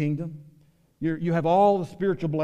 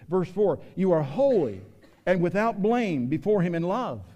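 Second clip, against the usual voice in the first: raised, pressing pulpit voice; preaching at full force; 6 semitones higher, 6 dB louder than usual. Acted. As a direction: pastor urging the congregation to protect their children, preaching hard. Reading promises from Ephesians.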